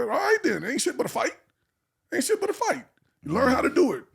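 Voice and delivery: high-pitched imitation of an unfazed mom; tough mother. Mother telling her beaten son to keep fighting, unfazed. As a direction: voice a no-nonsense mother, pitched well up.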